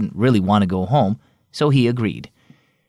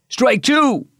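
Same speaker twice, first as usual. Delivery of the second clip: high, raised umpire's shout; 12 semitones higher than usual. A narrator's reading. Umpire shouting the second strike at Caden, raised call. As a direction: bellow the strike across the field. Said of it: "the umpire shouted".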